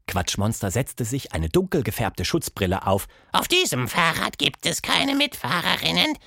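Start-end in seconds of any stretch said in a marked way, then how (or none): 3.3-6.2 much higher, far-off monster voice, offhand; casual snort. Acted. Quatschmonster boasting of his studio, high casual voice. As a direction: toss it off casually.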